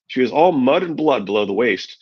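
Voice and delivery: ordinary delivery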